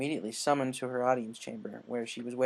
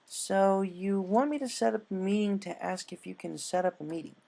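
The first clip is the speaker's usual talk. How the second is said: higher pony voice, puzzled; higher voiced unicorn double-checking; amused Twilight; 7 semitones higher than usual. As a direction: repeat the plan back incredulously.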